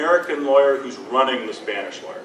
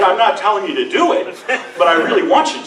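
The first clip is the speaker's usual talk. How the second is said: loud, raised-pitch mock disclaimer; comic insistence; 6 semitones higher, 7 dB louder than usual. Lawyer nudging colleague to relay threat, loudly insistent. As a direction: disclaim it loudly, then push anyway.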